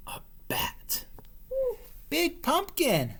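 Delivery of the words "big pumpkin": high, slow small bat; tiny voice of awe. Acted. Little bat admiring the pumpkin, small. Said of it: "said the bat".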